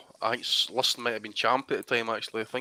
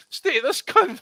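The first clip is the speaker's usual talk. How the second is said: high, mock-angry dad grumble; 13 semitones higher, 4 dB louder, much slower than usual. Son mimicking his dad complaining about the seat, pitched up. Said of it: "He turns to me and says".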